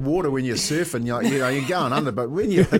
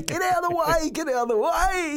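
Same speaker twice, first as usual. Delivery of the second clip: high panicked yell, laughing through it; frantic high-pitched shouting while out of control; screaming to get clear. Retold or imitated. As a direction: yell at kids to move out of the way. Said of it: "And I'm screaming".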